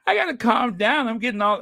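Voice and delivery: high laughing burst, then trying to settle; over-excited. Real, not acted. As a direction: laugh at yourself getting worked up. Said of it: "look at me getting all excited".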